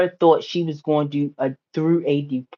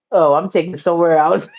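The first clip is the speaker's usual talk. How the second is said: ordinary talk, nothing marked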